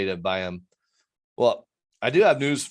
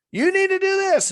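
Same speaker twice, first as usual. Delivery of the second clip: high, loud mock scream at an addict; imitating a scream; 7 dB louder than usual. Family screaming at their addicted loved one, loud mock yell. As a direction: imitate a family yelling orders. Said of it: "the family's screaming at 'em".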